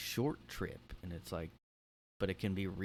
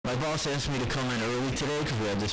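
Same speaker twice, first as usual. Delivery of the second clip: loud, raised mock caller voice; rant line caller; 4 semitones higher, 10 dB louder than usual. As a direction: gripe loudly down the line.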